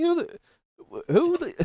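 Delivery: startled, high-pitched who; surprised. Real, not acted. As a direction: caught off guard. Startled by unknown voice.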